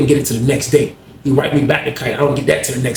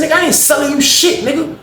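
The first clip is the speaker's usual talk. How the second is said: retold angry refusal, pitched way up; 12 semitones higher than usual. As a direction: snap it back at him, high. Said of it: "I said".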